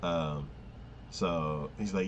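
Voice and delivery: ordinary delivery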